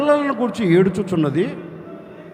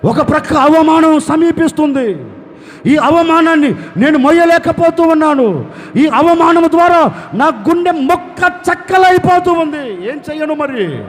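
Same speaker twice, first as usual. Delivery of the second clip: higher, louder, heart-broken cry to God; wailing lament; 6 semitones higher, 5 dB louder than usual. Acted. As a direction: pour out a mother's anguished lament. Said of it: "గుండెలో బాదుకుని రోధిస్తుంది". (రాహేలు దేవునితో రోదిస్తూ మొరపెట్టడం)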